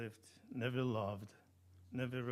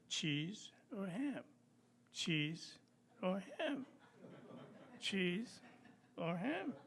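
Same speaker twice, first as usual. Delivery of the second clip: dithering mouse; 6 semitones higher than usual. Acted. Mouse dithering cheese or ham.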